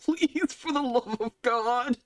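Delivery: high, begging dwarf voice; desperate dwarf barkeep. Acted. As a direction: plead with them desperately. Will's desperate glances.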